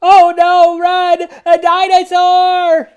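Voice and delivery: high, loud panicked cry; mock panic. A narrator's reading. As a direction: shriek as you flee the dinosaur. Grown-ups flee in panic.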